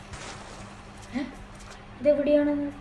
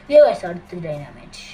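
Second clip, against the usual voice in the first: sudden loud alarmed cry; surprised shout; 4 semitones lower, 9 dB louder than usual. Real, not acted. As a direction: blurt it out startled. Spotting dynamite in game. (ഞെട്ടി ഉറക്കെ)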